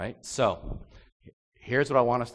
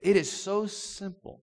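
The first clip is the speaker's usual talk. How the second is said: ordinary delivery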